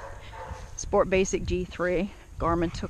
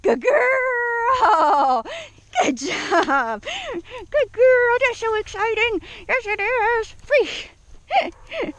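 high, drawn-out squeals of praise; excited praise; 5 dB louder, much slower than usual. Shown as a demonstration. Trainer making recall exciting for Daisy, high gushing praise. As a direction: make the dog thrilled it came. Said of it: "She's gotta be excited about it".

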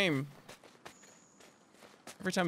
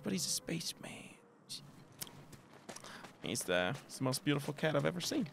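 ordinary talk

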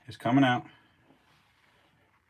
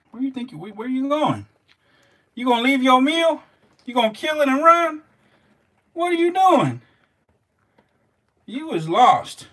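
high, loud disbelief at the snake; incredulous calling out; 13 semitones higher, 6 dB louder than usual. Real, not acted. Scolding the snake that drops its meal, high and incredulous. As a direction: call after her, baffled.

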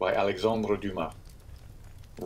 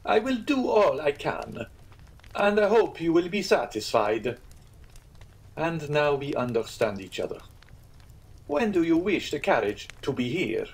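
higher, placating innkeeper; 6 semitones higher than usual. Acted. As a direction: give in smoothly. Pastrini concedes.

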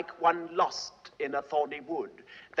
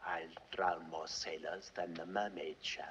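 hushed, voiceless, much softer and lower; menacing whisper; 11 semitones lower, 13 dB quieter than usual. Acted. Richard confiding his murderous plan to the audience in a menacing whisper. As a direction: breathe the threat like a secret. Villain confiding a dark boast.

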